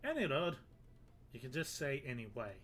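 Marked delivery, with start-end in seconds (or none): 0.0-0.6 a hammed-up, horrible Irish brogue; bad Irish accent. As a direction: play the Irish sailor, badly on purpose. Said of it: "I've made him Irish".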